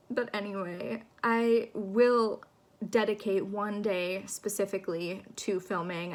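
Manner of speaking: usual speaking voice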